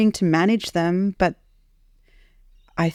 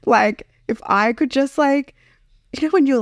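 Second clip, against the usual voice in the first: crying; 8 semitones higher, 3 dB louder than usual. Real, not acted. Still tearful after crying.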